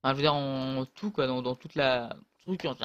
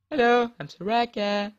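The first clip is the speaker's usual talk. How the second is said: slow, higher put-on hero greeting; mock champion voice; 7 semitones higher, 5 dB louder than usual. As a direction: announce yourself like a game character. Se présente en voix de Soraka. (voix de personnage, lente et aiguë)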